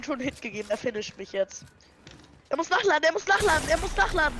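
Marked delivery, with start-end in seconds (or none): 2.5-4.4 higher, louder rapid repeats; urgent callout. Real, not acted. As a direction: push them on urgently. Urging attack while enemy reloads.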